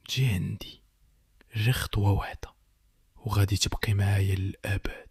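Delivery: soft, whispered luring call; a spirit mother whispering to her child; eerie whisper. A narrator's reading. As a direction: draw her in with a ghostly hush. Mother's voice from the door.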